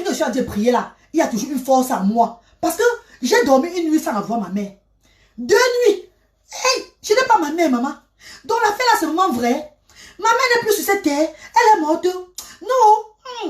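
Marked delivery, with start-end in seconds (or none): 5.4-13.5 high, pained cry of loss; grief breaking through. Retold or imitated. Daughter realising her mother is gone, high anguished voice. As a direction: let the grief rise and crack.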